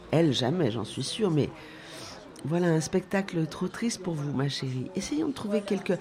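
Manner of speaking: ordinary delivery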